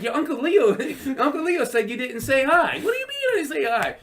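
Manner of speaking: lightly voiced nagging uncle; mock Uncle Leo. Uncle Leo scolding Jerry, high mock voice. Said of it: "He's like".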